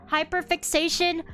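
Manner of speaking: usual speaking voice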